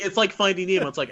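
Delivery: ordinary talk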